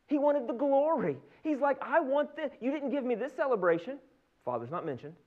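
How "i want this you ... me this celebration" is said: higher-pitched acted demand; jealous complaint. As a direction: protest like a slighted older brother. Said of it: "now they're jealous".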